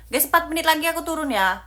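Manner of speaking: loud, high, rushed announcement; calling out. Real, not acted. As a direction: shout a quick heads-up to everyone. Calls out warning to viewers.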